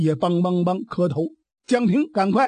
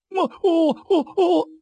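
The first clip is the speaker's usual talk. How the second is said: put-on high, halting little boy too scared to speak; frightened stammer; 8 semitones higher than usual. Boy trembling before his strict father, stammering in fear. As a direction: stammer it out like a terrified child.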